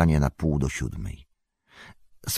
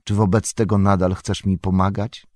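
usual speaking voice

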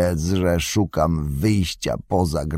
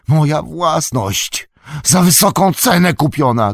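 high, loud grasping cry; snatch it back with a shriek; greedy shout; 10 semitones higher, 6 dB louder than usual. A narrator's reading. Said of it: "krzyknął Saruman".

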